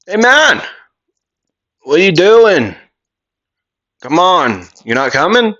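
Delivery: high, loud yell to a friend; calling out. Acted. Kirishima hailing Midoriya leaving the school, yelling out. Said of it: "Kirishima would yell out".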